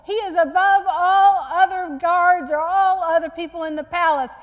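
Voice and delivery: loud public proclamation; calling out proudly. Retold or imitated. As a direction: proclaim it loudly to the crowds. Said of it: "He calls out in his loudest voice".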